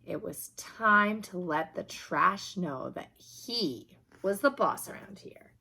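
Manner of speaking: plain reading voice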